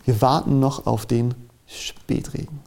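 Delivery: usual speaking voice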